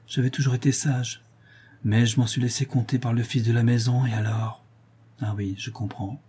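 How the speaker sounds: usual speaking voice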